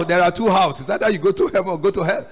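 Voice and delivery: normal talking voice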